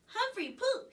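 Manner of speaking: high gleeful yell. A narrator's reading. Little boy yelling at the hamster cage, high and gleeful. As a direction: shout it out, giggling boy. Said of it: "he yelled".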